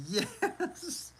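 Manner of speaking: squealed high yes, laughing; excited yes. Real, not acted. Streamer delighted by a chat suggestion, squealing yes.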